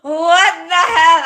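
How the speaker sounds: loud, slow laughing wail. Real, not acted. Laughing exasperation over the dream.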